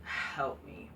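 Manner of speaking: low, soft, defeated plea; despairing mutter. Real, not acted. Fan fed up with WWE, low defeated mutter. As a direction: sink into despair. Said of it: "despairing aside".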